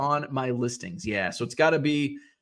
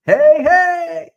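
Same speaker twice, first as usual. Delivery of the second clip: high, loud broadcaster's "Hey, hey!"; home run call; 14 semitones higher, 10 dB louder than usual. Retold or imitated. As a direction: belt it like an excited play-by-play man. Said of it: "That was Jack Brickhouse".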